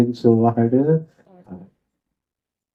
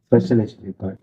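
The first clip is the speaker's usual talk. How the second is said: ordinary delivery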